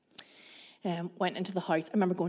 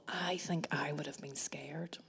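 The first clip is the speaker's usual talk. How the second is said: hushed and confiding; whispered; 4 semitones lower, 12 dB quieter than usual. Real, not acted. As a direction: whisper the admission. Confessing fear quietly.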